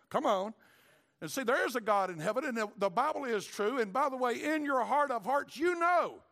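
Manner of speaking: preacher climbing to a peak; preaching build. Acted.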